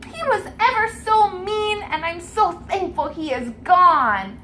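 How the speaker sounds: put-on high, sweet little girl voice; a small girl gushing thanks. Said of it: "And she says".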